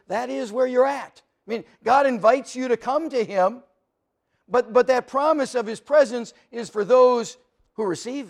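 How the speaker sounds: preacher pressing the lost to come to God, preaching at full force. Acted. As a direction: voice rising loud and high with conviction.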